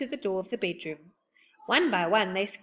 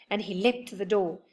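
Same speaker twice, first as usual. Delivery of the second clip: usual speaking voice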